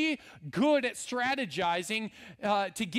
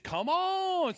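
drawled Southern "Come on" pitched up high; 9 semitones higher than usual. Shown as a demonstration. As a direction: stretch "Come on" with a Southern twang. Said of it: "add a little bit of Southern accent in it".

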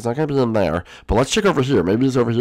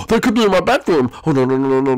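alarmed; 6 semitones higher, 5 dB louder than usual. Pretend. Sudden alarm about intruder in bedroom.

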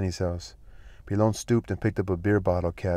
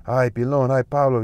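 hazy; 5 semitones higher, 7 dB louder than usual. A narrator's reading. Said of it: "he said hazily".

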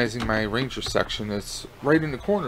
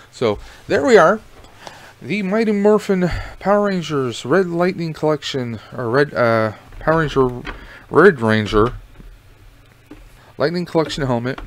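muffled in helmet; 3 semitones higher, 5 dB louder than usual. Real, not acted. Helmet on again.

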